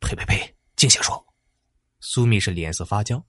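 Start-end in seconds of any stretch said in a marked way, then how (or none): 0.0-1.2 unvoiced, startled dismissal; shocked scolding without full voice; alarmed. A narrator's reading. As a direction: shush the bad omen at once. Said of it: "马卓大惊".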